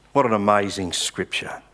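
ordinary talk, nothing marked

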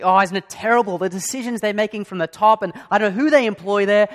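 hammed-up moan about management; mock grumbling. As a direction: coworker in the coffee line, loud mock grumble. Colleague complaining about work in line.

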